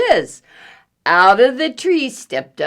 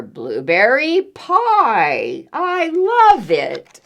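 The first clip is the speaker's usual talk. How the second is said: delighted bear; 2 semitones higher, 5 dB louder, slower than usual. Acted. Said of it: "said the bear".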